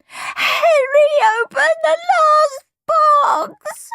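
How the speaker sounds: slow, very high, loud sobbing protest; tearful wail. A narrator's reading. Peter bursting into tears to Mum, wailing. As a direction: wail each word through tears. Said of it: "He burst into tears".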